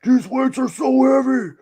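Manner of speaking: mock strongman. Artist voicing the bodybuilder in his sketch, high hammed-up strain. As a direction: complain like a lifter at the limit. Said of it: "I'm gonna".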